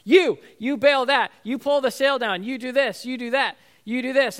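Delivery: acted crew boss barking out jobs; barking orders. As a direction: fire off orders like a fisherman in a storm. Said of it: "like, barking orders".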